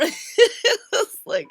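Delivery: breaks into laughter; laughing. Real, not acted. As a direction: laugh through the words. Laughing mid-sentence.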